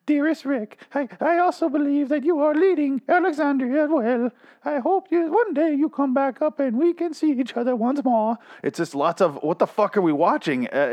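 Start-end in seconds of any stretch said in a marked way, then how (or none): 0.1-8.4 very high falsetto parody of a tender letter; mock falsetto Maggie. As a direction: read the reply in a high mocking lady voice. Mock reading Maggie's reply letter.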